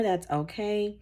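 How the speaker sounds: usual speaking voice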